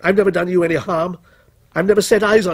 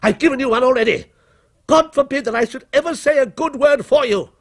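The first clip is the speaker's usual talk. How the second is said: angry outburst climbing ever higher; shouted denunciation; 7 semitones higher than usual. A narrator's reading. Author refuses his creation a good word, mounting angry outburst. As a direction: build from firm contempt to high defiance. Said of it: "he shouted".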